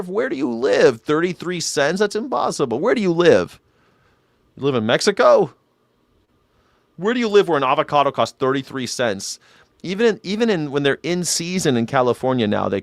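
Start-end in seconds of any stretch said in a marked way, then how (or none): 0.0-7.5 incredulous. Real, not acted. Disbelief at 33-cent avocados.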